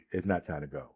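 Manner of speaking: second "It's not time to go" sinking low and quiet; fading to a murmur. Retold or imitated. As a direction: let the repeat die away to yourself. Repeats to himself, fading.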